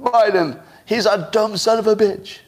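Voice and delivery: high, out-of-control screech, weakly pleading; screechy, pleading. Shown as a demonstration. Showing listeners the wrong delivery of an insult, screechy and pleading. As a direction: say it wrong, much too high and whiny-weak. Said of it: "Here's what would have been wrong".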